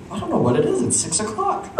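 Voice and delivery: high, confused mock drowsiness; sleepy. Drugged parents puzzled by tiredness, mock sleepy. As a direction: imitate a parent fading out. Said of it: "started getting really kinda sleepy".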